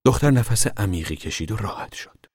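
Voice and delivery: ordinary talk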